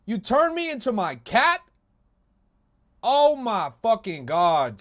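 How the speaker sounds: high, loud exasperated shout; shocked outrage. Real, not acted. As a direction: yell it in disbelief. Streamer reacting to memes.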